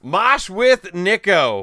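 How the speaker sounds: loud repeat announcement, short of screaming; announcing loudly. Real, not acted. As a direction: call the winner's name clearly and loudly. Repeats the drawn name less forcefully.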